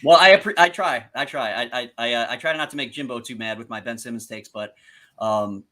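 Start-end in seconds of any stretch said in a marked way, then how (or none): none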